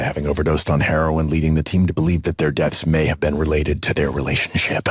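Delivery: deep, booming announcer read; fighting game announcer. Pretend. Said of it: "announcer voice".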